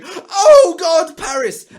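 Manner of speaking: high, loud, hammed-up wail of grief; mock outrage. Mocking Facebook posters grieving Paris, high hammed-up wail. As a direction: parody an overwrought poster clutching their pearls. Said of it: "people going".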